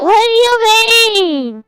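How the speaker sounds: high, slow, drawn-out squeal; high effect voice. Pretend. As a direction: stretch it out high through a voice effect. Playing with a pitched voice effect.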